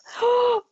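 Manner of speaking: sharp high gasp of fright; real jump-scare gasp; startled gasp. Real, not acted. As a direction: caught off guard, a sudden high gasp. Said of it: "you made me jump out my skin".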